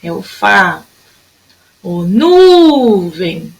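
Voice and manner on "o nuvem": "nuvem" called out with a very long nu; chamando, NU esticado. Shown as a demonstration. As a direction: stretch the nu of "nuvem" in a calling tune. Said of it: "Sempre vai chamar a palavrinha". ("nuvem" chamado com o nu bem comprido)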